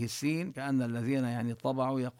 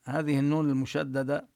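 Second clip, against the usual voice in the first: ordinary talk, nothing marked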